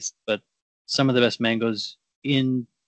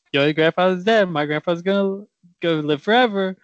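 higher, sing-song mock of a gloating kid; mocking taunt; 6 semitones higher than usual. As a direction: taunt him like a smug schoolyard rival, lightly. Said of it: "Kept saying".

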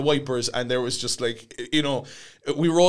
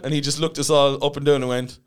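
usual speaking voice

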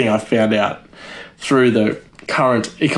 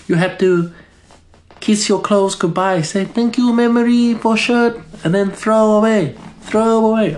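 Marie Kondo; 10 semitones higher than usual. Acted. Comedian mimics Netflix tidying host, high accented mock voice. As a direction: imitate a TV tidying guru, pitched way up. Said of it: "I'm just doing a voice".

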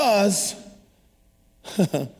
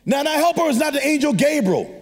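preaching; 7 semitones higher than usual. Acted. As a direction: preach it with rising fire. Long preached passage.

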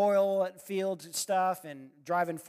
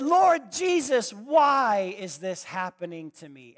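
anguished cry; 2 semitones higher, 7 dB louder than usual. Retold or imitated. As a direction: wail it up to heaven. Desperate cry to God retold.

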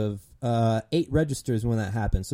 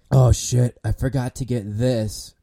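mock forgetful customer; 2 semitones lower, 4 dB louder, slower than usual. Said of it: "and then you're like".

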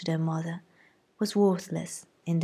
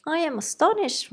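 high, affronted mother's cry; indignant mother; 10 semitones higher, 4 dB louder than usual. A narrator's reading. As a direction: squeak out her offended surprise. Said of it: "said Missus Bennet".

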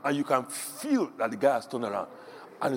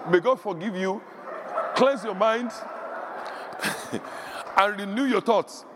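mock blessing; 6 semitones higher, slower than usual.

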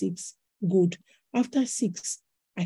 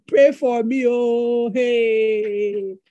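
mock humble plea; 2 semitones higher, 5 dB louder, much slower than usual. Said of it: "I am so humble to ask, like".

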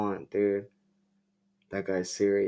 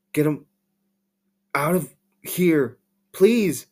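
higher and louder, rising to a desperate please; frightened plea; 6 semitones higher, 6 dB louder than usual. Acted. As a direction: beg the officer to get him out, panicking. Terrified woman begs officer.

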